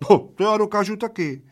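high, loud squealed protest; shrieking. A narrator's reading. Small man rising to the challenge, shrieking. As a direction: squeal it out indignantly, pitched right up. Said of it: "zajčel maličký".

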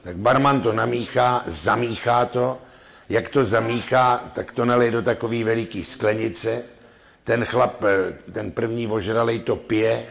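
ordinary talk

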